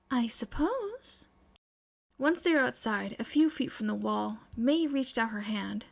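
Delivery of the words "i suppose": slow, drawn-out hesitant agreement; hesitant girl agreeing slowly; reluctant. Acted. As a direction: give in, dragging it out.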